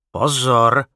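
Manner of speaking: lightly voiced giant. A narrator's reading. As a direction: call out a firm warning as a big man. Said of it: "řekl obr".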